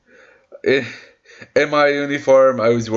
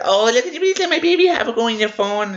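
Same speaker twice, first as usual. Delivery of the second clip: higher, quick wheedling mother voice; higher pleading mock mother; mock entitled mother; 9 semitones higher, faster than usual. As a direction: beg like the pushy mom, mockingly. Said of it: "The mother said".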